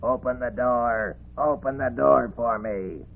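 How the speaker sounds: plaintive summons from afar; calling from outside. Acted. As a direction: call to be let in, stretching the words. Frog calls from outside the door.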